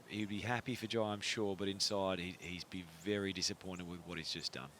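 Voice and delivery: ordinary talk, nothing marked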